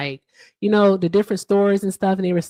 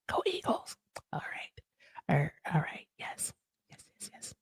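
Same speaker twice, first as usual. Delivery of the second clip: unvoiced whisper; hushed mock cheer; 4 semitones lower, 14 dB quieter than usual. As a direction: breathe it like a secret. Whispered aside after sports talk.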